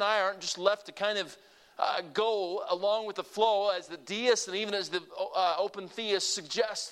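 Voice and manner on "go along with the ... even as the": usual speaking voice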